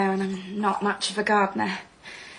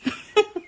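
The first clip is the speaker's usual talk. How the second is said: short, high, out-of-place laugh; a brief laugh that lands wrong; jarring laugh; 13 semitones higher than usual. Acted. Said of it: "her laugh jarred somewhat".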